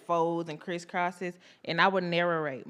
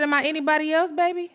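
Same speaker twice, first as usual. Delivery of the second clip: high teasing question; 8 semitones higher, 4 dB louder, faster than usual. Retold or imitated. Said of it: "I said".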